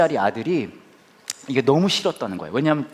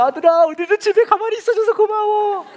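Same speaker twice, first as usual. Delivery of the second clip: hammed-up doting father; 6 dB louder than usual. Father thanking his son for skipping school, mock tearful. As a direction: overdo the grateful teary dad.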